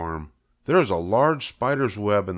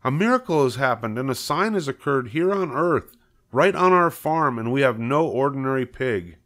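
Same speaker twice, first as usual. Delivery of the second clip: higher-pitched, building excitement; excited insistence; 6 semitones higher than usual. Acted. Husband telling wife of the miracle in the web, excitedly insistent. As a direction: proclaim the wonder and insist it is true.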